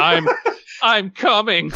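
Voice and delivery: high, halting stutter. A narrator's reading. Ellis answering his friend after being shaken, stuttering. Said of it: "Ellis stuttered out".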